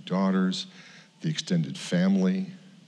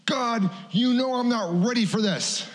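retold scream at God. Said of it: "I'm screaming at God".

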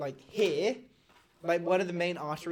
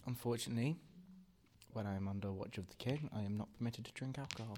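softer, lower, formal guard's refusal; stiff dutiful mage; 5 semitones lower, 11 dB quieter than usual. Acted. Mage under the king's watch turning down a drink, softer and lower. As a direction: refuse politely, rule-bound and quiet.

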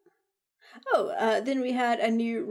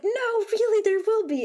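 high, loud insisting; pleading that more is coming; insisting to Cody there'll be a stinger, high and loud; eager insistence; 11 semitones higher, 4 dB louder than usual. Retold or imitated.

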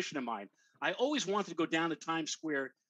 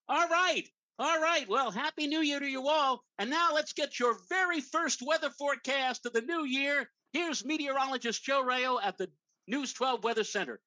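bubbly TV reporter; 8 semitones higher than usual. Retold or imitated. Reporter's on-air hand-off.

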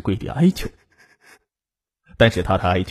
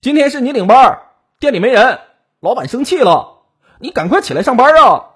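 high, forceful yell through a door; raised, pressing holler from outside; loud shouting; 11 semitones higher, 6 dB louder than usual. A narrator's reading. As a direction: shout urgently to rouse a coworker. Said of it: "大喊道".

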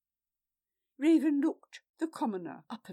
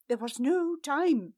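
raised, pleading voice; urgent plea; 5 semitones higher, 7 dB louder than usual. A narrator's reading. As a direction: plead loudly. Vala pleading.

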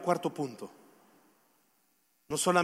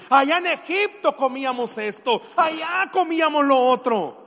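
loud, higher mock grumbling; acted-out grumbling; grumbling complaint; 7 semitones higher, 7 dB louder than usual. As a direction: complain like the discontented Israelites. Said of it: "comenzaron a murmurar y comenzaron a decir".

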